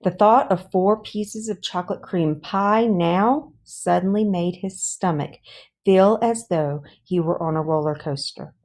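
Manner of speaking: ordinary delivery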